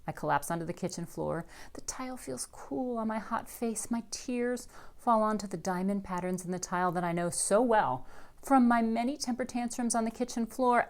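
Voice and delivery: ordinary delivery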